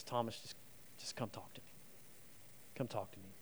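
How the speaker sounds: soft, low, almost breathless whisper; tender whisper. Acted. Jesus calling doubting Thomas to him, whispered tenderly. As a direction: draw him in gently, barely voiced.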